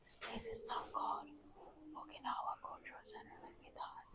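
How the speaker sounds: whispering in a cave; hushed voice echoing in cave. Real, not acted.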